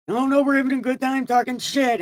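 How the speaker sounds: lightly mock the carefree chatter; mock cheerful voice. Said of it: "It's like".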